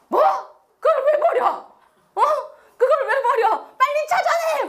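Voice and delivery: high-pitched indignant outburst; angry second son. Acted. Second son scolding brother over lost money, angry. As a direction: act out a brother's shocked, cross demand. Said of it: "둘째 아들은 막 화를 내고".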